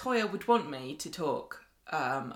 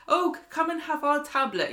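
higher, put-on welcoming voice; mock eager host; 8 semitones higher than usual. Mocking Scots offering tablet, put-on eager voice. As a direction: play someone pressing sweets on a guest.

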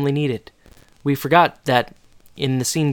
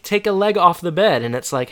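ordinary delivery

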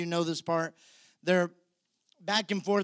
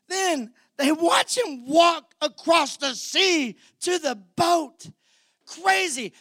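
high, loud, building preacher's cry; preaching at full force; 7 semitones higher, 6 dB louder than usual. Real, not acted. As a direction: let the wonder build into a shout.

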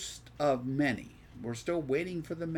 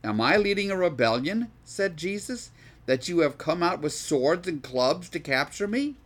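higher, louder projected Bible reading; scripture reading aloud; 4 semitones higher, 6 dB louder than usual. Acted. Teacher reads Jesus challenging his captors, forceful scripture reading.

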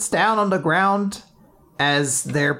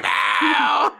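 high, acted scream of protest; mock scream; 8 semitones higher than usual. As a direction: scream it in hammed-up horror. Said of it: "Latla just screams".

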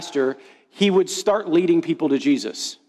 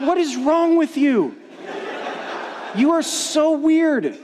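higher-pitched retold disbelief, drawn out at the end; mock exasperation; 7 semitones higher, slower than usual. Embarrassed diner to his cheesy pastor buddy, exasperated. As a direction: act out being appalled by a friend.

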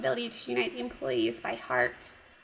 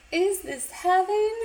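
high and slow "Is this heaven?" as the fixed quote; corrected line, higher and slow; 7 semitones higher than usual. Retold or imitated. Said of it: "Or sorry".

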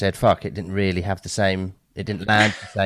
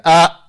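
high, loud teacher's warning sound; sharp reproving "ah"; 9 semitones higher, 12 dB louder than usual. Retold or imitated. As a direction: cut in like a teacher parent catching a swear. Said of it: "an immediate reaction to go".

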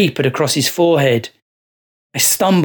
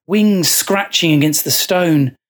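ordinary delivery

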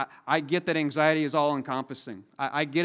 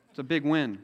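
normal talking voice

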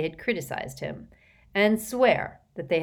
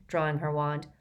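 usual speaking voice

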